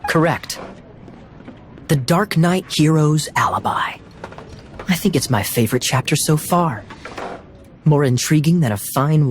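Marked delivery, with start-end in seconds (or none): 1.9-4.0 hushed, teasing whisper of a title. Acted. Kaya teases Diluc, whispering the chapter title. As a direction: breathe the title like a secret.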